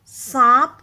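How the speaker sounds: teacher showing the chandrabindu sound; loud nasal demo. Shown as a demonstration. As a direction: ring सांप out through nose and throat. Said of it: "गले और नाक दोनों में एक vibration".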